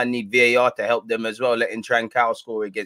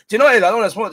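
excited outburst; 9 semitones higher, 9 dB louder, much faster than usual. Real, not acted. Building up to a rant.

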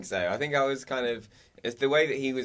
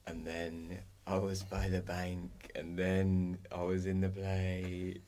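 slow, low, dreamy mumble, lightly mimicking herself; slow dazed talking; 5 semitones lower, 9 dB quieter, much slower than usual. Mimicking her own sleepy dream recording, slow and low. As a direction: drag the words like you're half asleep. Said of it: "I'm literally, like, talking".

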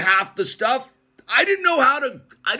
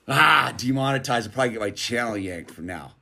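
mock dismay; 9 semitones lower, 4 dB quieter than usual. Real, not acted. Joking aside after edgy line.

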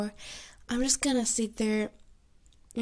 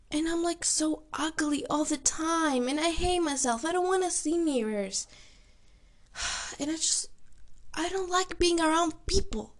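higher voice, tearful confession; real crying; 5 semitones higher than usual. Real, not acted. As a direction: let real tears push the pitch up. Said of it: "Sorry that I'm crying right now".

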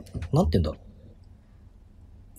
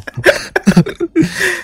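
high laugh; 3 dB louder than usual. Real, not acted.

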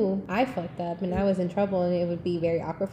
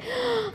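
joyful gasp; 5 dB quieter than usual. Retold or imitated. Mom gasps with joy.

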